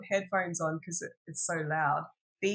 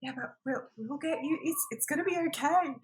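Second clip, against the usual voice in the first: reassuring; 10 semitones higher than usual. Retold or imitated. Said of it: "and I'm like".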